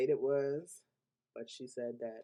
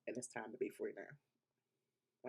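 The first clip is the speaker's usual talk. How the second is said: getting emotional; 2 semitones lower, 8 dB quieter, slower than usual. Real, not acted. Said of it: "I'm getting emotional".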